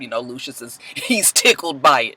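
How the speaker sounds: ordinary talk, nothing marked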